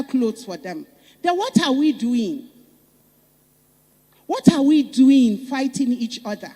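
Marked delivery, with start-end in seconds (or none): 1.2-6.5 louder and higher, exasperated; an appalled public challenge; indignant rhetorical question. Real, not acted. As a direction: demand an answer from the crowd.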